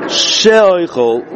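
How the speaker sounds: she'oichel with the "she" drawn out a little too long; elongated shin. Shown as a demonstration. Teacher demonstrating the stretched "she" to students. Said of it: "where I emphasize she'oichel".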